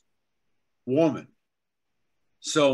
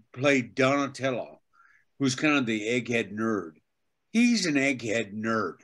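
ordinary delivery

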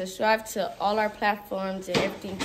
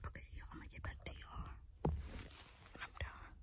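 whispering while hiding; 13 dB quieter than usual. Real, not acted. Hiding in closet during hide and seek.

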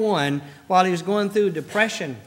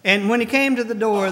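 usual speaking voice

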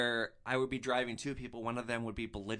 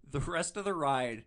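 usual speaking voice

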